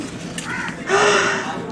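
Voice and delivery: sharp unvoiced gasp; a storyteller's dramatic gasp. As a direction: draw in a startled breath.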